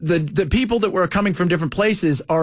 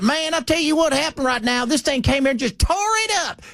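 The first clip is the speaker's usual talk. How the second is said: excited Southern local; 8 semitones higher than usual. Retold or imitated. Witness yelling to news crew in thick Southern accent. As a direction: play the shirtless tornado witness yelling to the reporter. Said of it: "He's like".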